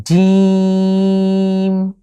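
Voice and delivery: জিম held long and loud; long Arabic jim. Shown as a demonstration. Teacher shows Quran letter jim to viewers, drawn out. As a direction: stretch জিম over three counts, loud and steady. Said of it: "আমি জিম যে উচ্চারণ করছি".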